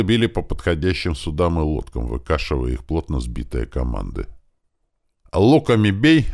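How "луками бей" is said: loud, high order to archers; shouted command. A narrator's reading. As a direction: shout the order across the wall. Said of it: "прокричал Варун". (громкий приказ лучникам)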